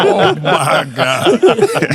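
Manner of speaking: rising laugh into a slow oh my God; real laughter while retelling. Real, not acted. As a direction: laugh at your own embarrassment. Laughing at his own cursing.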